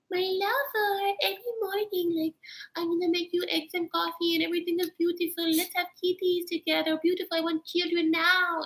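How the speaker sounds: high, sugary lovestruck gushing; dreamy lovestruck woman. Retold or imitated. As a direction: gush like a girl head over heels. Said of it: "goes from being like".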